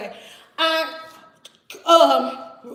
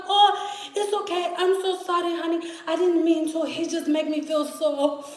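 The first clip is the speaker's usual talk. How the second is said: higher-pitched petite roommate imitation; cute little roommate; 3 semitones higher than usual. Petite roommate excuses herself to the storyteller, high cutesy imitation. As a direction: mimic a tiny apologetic woman, pitched up. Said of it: "like, cute little petite little".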